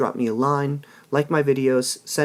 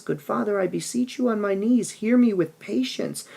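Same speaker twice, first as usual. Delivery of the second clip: put-on much higher, begging voice of a kneeling girl; pleading daughter; 10 semitones higher than usual. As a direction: beg your father on your knees. Juliet kneels and begs father.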